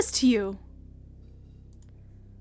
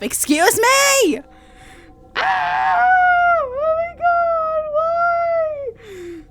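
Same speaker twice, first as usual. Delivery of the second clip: high, slow drawn-out disbelief; real high-pitched outrage; shocked outcry; 6 dB louder, much slower than usual. Real, not acted. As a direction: shriek in stunned disbelief. Reacting to his self-inflicted wound.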